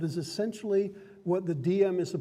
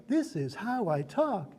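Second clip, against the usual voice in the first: sing-song; 2 semitones higher, 3 dB quieter, slower than usual. Shown as a demonstration. Game master demonstrating a wistful character, sing-song. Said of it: "you want a sing-song voice".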